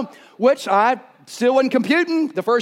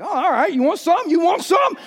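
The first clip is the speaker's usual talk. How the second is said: mock challenge; 6 semitones higher than usual. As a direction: puff up and taunt the goat. Wrestling the goat, taunting.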